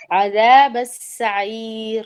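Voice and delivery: عذاب السعیر drawn out slowly, each letter articulated; slow tajweed recitation. Shown as a demonstration. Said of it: "اس کو کس طرح سے پڑھیں گے صحیح طرح سے".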